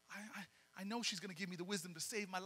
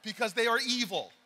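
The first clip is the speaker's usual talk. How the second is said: loud, mock-serious indictment; louder mock-solemn accusation; mock outrage; 10 dB louder than usual. Real, not acted. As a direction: sell the joke in earnest.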